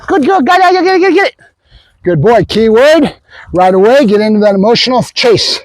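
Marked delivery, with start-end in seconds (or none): 0.1-1.3 high, rapid-fire egging on; high-pitched play chant to a dog; excited urging. Real, not acted. Urging dog to chase toy.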